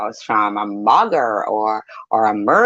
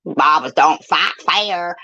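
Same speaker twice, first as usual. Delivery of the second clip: much higher, slow whiny mock voice; mock victim whine; 8 semitones higher than usual. Mocking a rival who claims she's bullied, in a whiny voice. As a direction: play the wounded victim, hammed-up. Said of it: "And now it's all".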